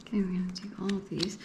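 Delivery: fast hushed whisper; quick whisper. Real, not acted. Reader gathering a stack of cards, quick whisper.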